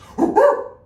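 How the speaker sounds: high, loud monkey hoot; monkey noise. Shown as a demonstration. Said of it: "make some monkey noises".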